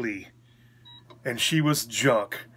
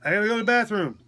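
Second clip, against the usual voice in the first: high-pitched mimicked plea to the nurses; higher voice of an imitated patient; 6 semitones higher, 3 dB louder, faster than usual. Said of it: "I can't understand you".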